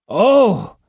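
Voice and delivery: loud startled Oh; sudden loud recognition; gasp. Acted. Matahachi gasping as he recognises the old monk. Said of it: "Matachi gasped loudly".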